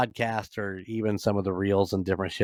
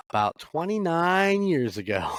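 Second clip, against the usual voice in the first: pitched way up in disbelief; amazed emphasis; 8 semitones higher than usual. Real, not acted. Telling listeners he met the actor, amazed by the years. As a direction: marvel at how long ago it was. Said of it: "I know, right?".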